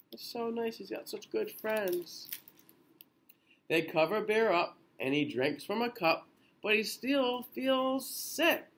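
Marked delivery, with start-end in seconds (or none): 3.7-6.3 lilting storybook read-aloud; gentle bedtime picture-book reading; reading to children. A narrator's reading. 6.6-8.6 slow lilting refrain line; sing-song storybook refrain; drawn-out refrain. A narrator's reading.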